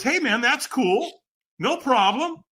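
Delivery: high-pitched, easygoing guy voice; easygoing reassurance. Acted. Trainer backing off after a refusal, easygoing reassurance.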